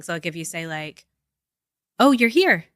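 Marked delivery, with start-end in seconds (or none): 2.0-2.6 "Oh, you're here" said higher and louder, gladly; glad surprise. Shown as a demonstration. Showing how tone changes meaning, a pleased welcome. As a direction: greet them like a welcome surprise. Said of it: "tone carries so much meaning".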